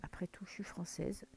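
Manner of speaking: aparté soufflé, presque sans voix; aparté chuchoté. Real, not acted. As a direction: glisser la remarque tout bas, pour soi. Aparté sur son accent. (aparté chuchoté sur son accent français)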